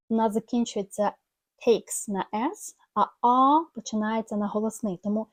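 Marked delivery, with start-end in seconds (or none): none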